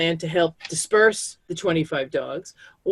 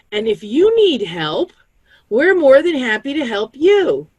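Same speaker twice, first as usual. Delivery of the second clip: grateful neighbours; 7 semitones higher, 6 dB louder than usual. Retold or imitated. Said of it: "came to my house and said".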